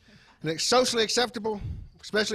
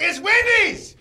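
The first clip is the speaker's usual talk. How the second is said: high, loud mock holler; imitated scream back; 11 semitones higher, 9 dB louder, much slower than usual. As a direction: yell the correction, hammed-up indignation. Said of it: "he screamed back".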